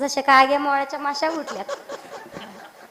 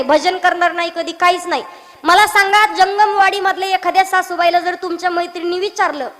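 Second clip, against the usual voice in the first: ordinary talk, nothing marked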